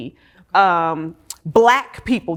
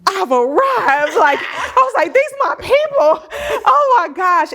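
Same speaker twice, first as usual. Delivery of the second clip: high, laughing shout of joy; ecstatic arrival; 13 semitones higher, 6 dB louder than usual. Retold or imitated. Arriving at Clark Atlanta, shouting with laughing joy. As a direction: burst out like a sitcom star who's arrived. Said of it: "like a sitcom where I am".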